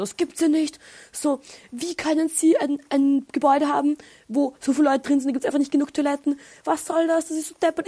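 mock outrage, voice pushed up high; grumpy rant. Retelling her grumpy outburst at the club toilet queue, exasperated and high. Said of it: "Und dann war ich so grantig".

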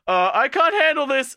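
high, loud, panicked squeal; a girl fleeing in a high loud panic. Retold or imitated. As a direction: shriek it out like she's overwhelmed. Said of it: "redhead girl's just like".

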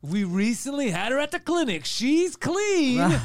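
hammed-up announcer, climbing high; strip club DJ. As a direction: sell the dancer to the crowd, stretching the last words. Plays strip club DJ introducing dancer.

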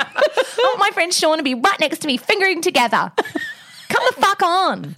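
high, laughing mock bloke voice, hammed up; mock boorish bloke. Mocking what the bouncer heard, hammed-up high bloke voice. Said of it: "that's what he's hearing".

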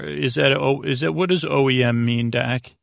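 ordinary delivery

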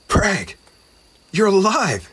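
a little goblin crying out at his friend's return; joyful cry. Acted. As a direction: burst out in relieved joy. Goblin finds lost friend alive.

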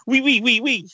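high, hammed-up French "oui, oui"; mock French. Mock French agreement to pitch an advert. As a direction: chirp it like a cartoon Frenchman. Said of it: "like a Frenchman agreeing with us".

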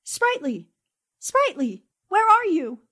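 loud anxious calling out. Acted. Annie calling for Sprightly after the crash, anxious and loud. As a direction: call across the dark to find him.

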